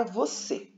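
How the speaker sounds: "você" com peso no CÊ; ênfase na última sílaba. Shown as a demonstration. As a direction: destaque o CÊ final de "você". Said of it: "quando a sílaba tônica é a última". ("você" com a última sílaba mais forte)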